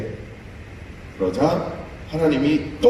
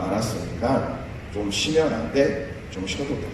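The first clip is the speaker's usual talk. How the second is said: tender comfort; 4 dB quieter than usual. Acted. God comforting tired Moses.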